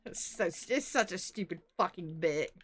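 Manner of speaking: normal talking voice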